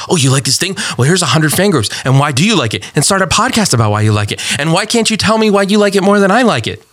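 rapid-fire demands climbing in pitch; mock pushy fan. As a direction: pile on questions, mockingly insistent. Mock pushy fans piling on demands.

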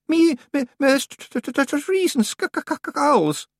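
high stuttering through chattering teeth; frozen girl trying to explain; chattering stammer. Acted. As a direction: stumble over every sound, shivering. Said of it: "her teeth were chattering".

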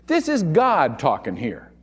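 ordinary talk

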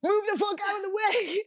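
high-pitched frantic order; mock yell. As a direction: act out clearing people from the way. Acts yelling at bystanders.

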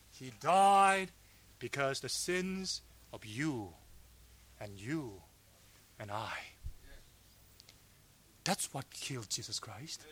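very low, soft voice with long pauses; hushed, grave preaching. Real, not acted. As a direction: let each phrase sink in quietly.

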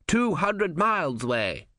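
drawn-out high voice from the crowd; slow high voice. A narrator's reading.

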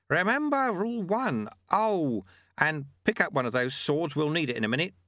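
high-voiced monk instructing his pupil; Lutze, brisk master. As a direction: remind the apprentice, then give an order.

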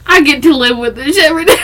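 exasperated but cracking up; laughing complaint. Real, not acted.

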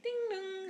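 high, sing-out doorbell chime; imitated doorbell. Turning up at his house to confront him, imitated doorbell. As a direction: mimic a doorbell with the voice.

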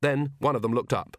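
usual speaking voice

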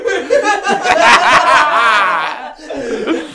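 high, helpless laughter; can barely talk for laughing; laughing hard. Real, not acted. Cracking up over the food.

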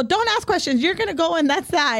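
high, insistent mock scolding; retold yelling. Aunt ordering nephew to go, mock yelling. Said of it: "tell her I yelled at you".

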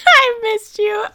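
high, breaking mock sob on missed you; hammed-up ex crying. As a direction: fake tearful plea from an ex. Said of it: "Do I sound like your ex trying to get back with you yet?".

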